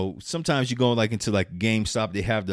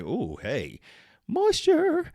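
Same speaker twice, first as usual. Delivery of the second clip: delighted moisture; much slower than usual. Pretend. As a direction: squeal moisture very high. Skin meets humid air.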